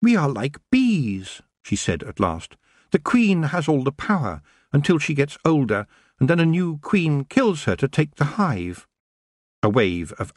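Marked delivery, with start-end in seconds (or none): 0.0-1.4 high, slow elf voice; measured and sly, pitched high; crafty elf queen choosing words; cunning Nightshade. A narrator's reading. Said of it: "Cunning flickered across Nightshade's". 2.9-8.9 high-pitched, sly elf voice; scheming little queen; cunning elf queen. Acted. Elf queen speaks in story.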